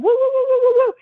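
high, loud playful woo-woo cry; imitated war whoop. Little girl with cap guns whooping in the bedroom. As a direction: whoop like a kid playing Indian chief. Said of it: "And she's going".

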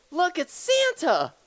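very high, loud announcing shout; acted yell. Dad startling kid with fake Santa, yelling high and loud. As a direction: yell it like a big surprise reveal. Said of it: "turn on the lights really quick and yell".

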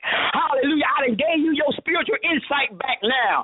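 high, driving sermon delivery; full-force preaching. Real, not acted. Preacher delivering God's promise of blessing, fervent preaching.

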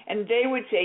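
usual speaking voice

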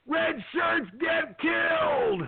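high, slow mock shout from the crowd; blurted out. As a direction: call it out, dragging each word. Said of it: "somebody blurted out".

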